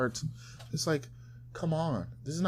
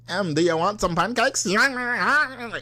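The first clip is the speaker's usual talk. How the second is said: high mock morning voice going haywire; overheating fembot; 7 semitones higher, slower than usual. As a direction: squeal upward like a malfunctioning fembot. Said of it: "Oh, she's overheating. She's a fembot".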